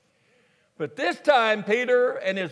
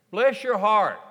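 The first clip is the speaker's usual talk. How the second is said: louder Texas-style "Bless your heart" with "Bless" punched; 5 dB louder than usual. Shown as a demonstration. Preacher shows the Texas version to the congregation, louder. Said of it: "New Revised Texas translation".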